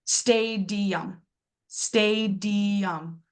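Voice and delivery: "stadium" slowly split as STAY-dee-um; syllable by syllable. Shown as a demonstration. Teacher hunting for the schwa, "stadium" in slow syllables. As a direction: separate each syllable of "stadium", ending on a schwa. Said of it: "I can hear that schwa".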